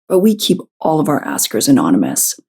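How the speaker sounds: usual speaking voice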